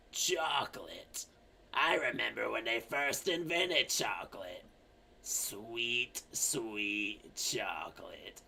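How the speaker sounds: slow, soft, low reminiscing; old lady. Acted. Old lady remembering chocolate's invention, slow hushed old voice. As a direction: reminisce quietly in an old woman's voice. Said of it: "the very old lady comes in".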